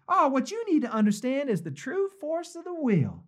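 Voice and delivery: high female Southern voice; Southern belle accent. Acted. Preacher voices the author explaining the will, high Southern accent. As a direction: put on a high Southern lady's lilt. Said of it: "half-baked female Southern accent".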